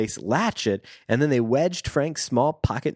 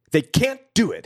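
snapped; 6 semitones higher, 4 dB louder than usual. Acted. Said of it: "he snapped".

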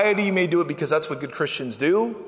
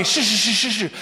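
rapid string of shushes; repeated sharp "shh"; shushing; 3 semitones higher than usual. Acted. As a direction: hush someone insistently. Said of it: "They tried to shush him up".

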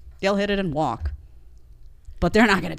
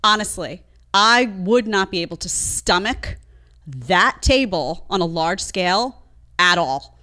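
ordinary delivery

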